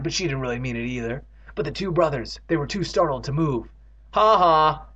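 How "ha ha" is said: loud, drawn-out mock yell; scream. A narrator's reading. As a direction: call it out loudly, half-earnest. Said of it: "Evan screamed".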